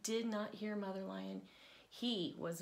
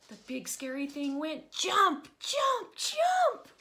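louder and much higher each jump; building jumps; 8 semitones higher, 11 dB louder than usual. A narrator's reading. Narrator scaring the children, shouting higher and higher. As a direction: leap up into a loud high cry.

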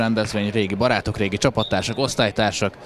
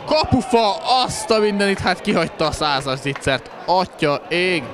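excited sports commentary; 9 semitones higher than usual. Real, not acted. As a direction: exclaim in shocked disbelief. Shot hits the post. (izgatott sportkommentár)

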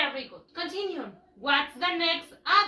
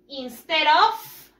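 instead of slow and louder, rising on of; stress on instead of; 6 dB louder, slower than usual. Shown as a demonstration. Teacher pointing pupils to the phrase, slow and firm. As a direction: lean on instead of. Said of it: "look here".

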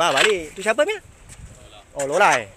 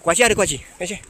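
ordinary delivery

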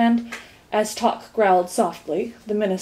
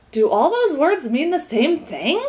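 higher, drawn-out, astonished question; slow down in mild amazement; gasped question; 6 semitones higher, 4 dB louder than usual. A narrator's reading. Milo questions the string of synonyms, gasped. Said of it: "gasped Milo".

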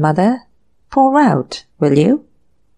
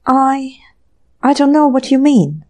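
gasped; 5 semitones higher than usual. A narrator's reading. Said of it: "gasped Dinsmore".

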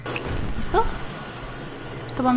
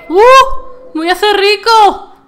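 higher, louder joyful shout; delighted cry; 6 semitones higher, 5 dB louder than usual. Real, not acted. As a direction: gloat happily. Delighted at being paid. (grito de alegría)

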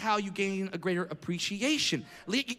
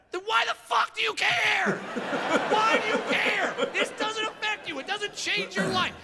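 furious rant; 12 semitones higher than usual. Real, not acted. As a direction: let the outrage explode. Ranting at the offended woman.

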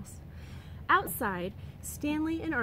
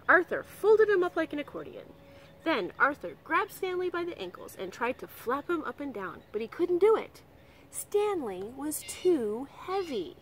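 ordinary delivery, nothing marked

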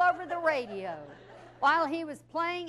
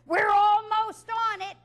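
higher and louder, slow and laughing; nervous rookie yelling over rotor noise; stalling shout; 7 semitones higher, 6 dB louder, slower than usual. Retold or imitated. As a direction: bluff loudly to buy time. Stalling the testy DJ on air.

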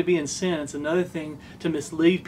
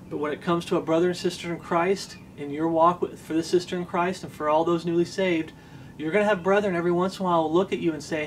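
ordinary delivery

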